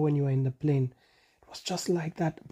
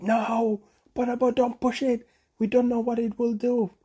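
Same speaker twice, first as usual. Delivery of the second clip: worried animals; 8 semitones higher than usual. A narrator's reading. Animals begging Bonobo not to push, high and anxious. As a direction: pitch it up high and plead. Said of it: "all the other animals said".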